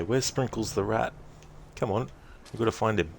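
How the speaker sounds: usual speaking voice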